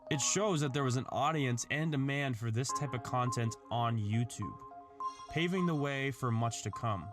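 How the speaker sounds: ordinary delivery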